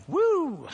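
high-pitched imitated whoop; high mock "woo". Wife whooping as people laugh, mock cheer. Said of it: "she's, like, going".

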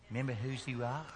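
soft imitation of mother's haunting reminder; sweet southern mama. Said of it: "in a little sweet southern voice".

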